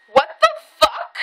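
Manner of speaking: very high, drawn-out disbelief; incredulous squeal. Retold or imitated. Climbing into friend's car, high incredulous squeal. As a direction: stretch it out slow and high. Her amazed reaction in the car.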